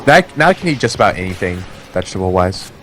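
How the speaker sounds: ordinary talk, nothing marked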